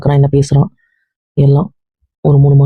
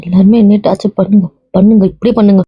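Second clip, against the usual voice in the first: distressed; 5 semitones higher than usual. Acted. Distressed outburst at family.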